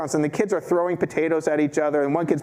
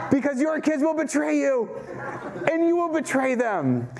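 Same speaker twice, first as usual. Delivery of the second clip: laughing; 11 semitones higher than usual. Real, not acted. Laughing at own family.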